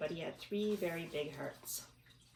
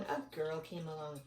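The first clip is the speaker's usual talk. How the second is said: ordinary delivery, nothing marked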